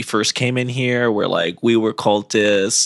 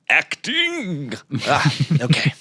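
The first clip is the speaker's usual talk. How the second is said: character voice warm-up; 3 semitones higher, slower than usual. Shown as a demonstration. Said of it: "Let me get into my character voice".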